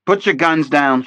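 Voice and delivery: loud, firm command; firmly. A narrator's reading. Jesse Flanagan orders the FBI agents, firm loud command. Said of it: "she said firmly".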